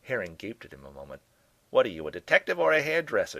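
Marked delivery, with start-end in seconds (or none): 1.7-3.4 high, loud scoff; mock him with disbelief. A narrator's reading. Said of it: "she finally scoffed".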